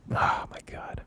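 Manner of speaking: breathy whisper, no voice. Real, not acted. Whispering to herself after the dark film talk. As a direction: whisper it to yourself in disbelief. Aside after serious disclaimer.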